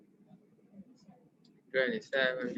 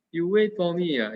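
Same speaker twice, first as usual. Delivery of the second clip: louder barking army sergeant; 4 semitones higher, 7 dB louder than usual. Pretend. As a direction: bark it at the student like a drill sergeant. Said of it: "now is the army sergeant".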